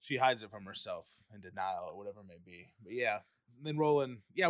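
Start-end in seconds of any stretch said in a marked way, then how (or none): none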